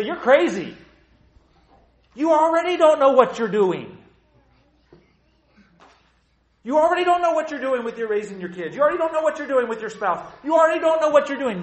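high, loud dismissive jeer; high, loud sarcastic pushback; scoffing retort. Acted. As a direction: throw it back at God mockingly. People scoffing back at God.

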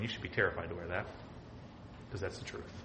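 a low warning aside; retorting quietly. Retold or imitated. Preacher answering the t-shirt, dropping to a whisper. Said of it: "I'm going".

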